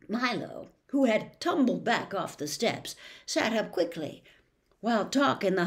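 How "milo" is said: usual speaking voice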